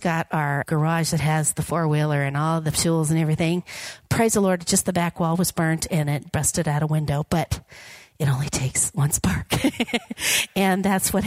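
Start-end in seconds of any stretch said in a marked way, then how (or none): none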